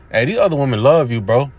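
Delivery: usual speaking voice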